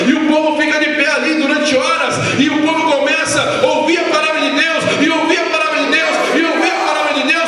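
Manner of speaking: high-pitched, insistent revival preaching; fervent pulpit cry; full-force preaching. Real, not acted. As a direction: build relentlessly in rising, chanted waves.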